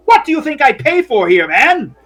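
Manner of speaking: high, loud indignant bellow; angry yelling. Acted. Man with stained jacket berating Fred, outraged. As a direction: berate the help as a wronged customer. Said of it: "starts yelling at Fred".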